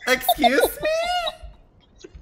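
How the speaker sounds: drawn-out, very high offended "excuse me?"; indignant excuse me. Real, not acted. Gamer stunned after being cursed at, indignant drawn-out disbelief. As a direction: stretch it out in shocked disbelief.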